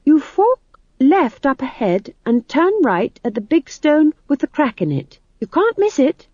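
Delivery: high, emphatic rehearsed directions; McGrath giving directions. Acted. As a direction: point the way in a high voice.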